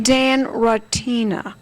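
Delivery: slow, careful "Rotina" with an open "ah" in the first syllable; pronunciation demo. Shown as a demonstration. Host teaching guest how to say the name, slow careful pronunciation. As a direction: say "Rotina" slowly as "rah-TEE-na". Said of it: "That's the way you say his name".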